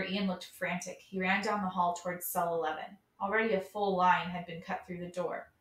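usual speaking voice

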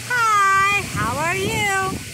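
high, loud cheery hi; cheerful hello. Acted. Child greets the bird at the window, loud. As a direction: call out a bright greeting. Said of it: "to the window to say".

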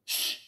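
loud sharp "shh"; hushing hiss; shushing. A narrator's reading. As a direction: shush the braggart. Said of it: "went Eddie".

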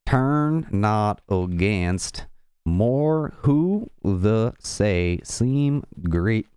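slow, stretched Southern accent; effortful Southern word-by-word reading. Shown as a demonstration. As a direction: drawl each listed word separately in a Southern accent. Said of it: "trying to do this in the southern accent".

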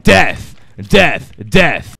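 loud, punched, falling shouts; repeated battle shout. Pretend. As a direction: bark each one like an attack. Mock battle cry while gathering.

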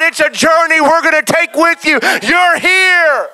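loud, high, ringing sermon peak; full-force preaching. Acted. Pastor assuring returning prodigals, full-force preaching. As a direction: preach it to the rafters.

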